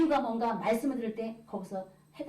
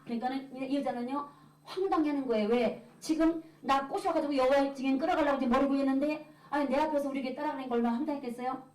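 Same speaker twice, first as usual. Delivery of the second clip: ordinary delivery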